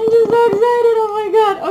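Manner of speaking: high loud squealing joy; excited squeal. Real, not acted. Unboxing a gift for viewers, squealing with excitement. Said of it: "I'm just so excited".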